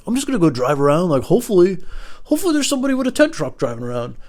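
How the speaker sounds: high, quick mock voice; mocking stranger's voice. Teller mocking how the man plans his wedding, high quick voice.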